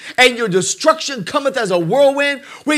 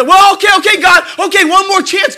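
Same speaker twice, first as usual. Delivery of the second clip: pleading; 9 semitones higher, 6 dB louder than usual. Retold or imitated. Sinner begging God again.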